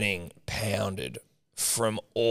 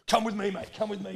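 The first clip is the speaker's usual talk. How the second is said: urgent bodyguard; 9 semitones higher, 5 dB quieter, faster than usual. Retold or imitated. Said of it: "He's like".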